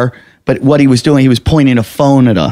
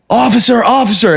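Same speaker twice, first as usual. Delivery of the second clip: high, urgent hail to the police; retold call for help; 8 semitones higher than usual. Runner spotting cop lights, calling out.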